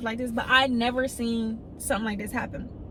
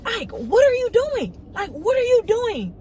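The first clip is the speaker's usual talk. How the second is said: higher, louder shocked call; yelling across the yard in shock; 9 semitones higher, 4 dB louder than usual. Retold or imitated. Calling out at tackling man.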